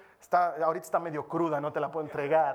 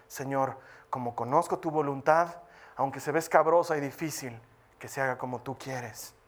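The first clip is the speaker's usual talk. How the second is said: humble prayer; 5 semitones lower than usual. Acted. Risky surrender prayer.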